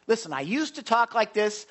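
ordinary talk

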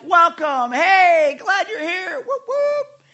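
high, loud cheering with whoops; excited welcome. Acted. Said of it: "Oh, they're welcoming him".

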